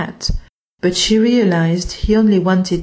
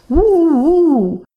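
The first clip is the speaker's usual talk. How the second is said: high, loud little wolf howl; 9 semitones higher, 4 dB louder than usual. Acted. Wolf howls for the mouse, high and loud. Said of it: "What a nice cry".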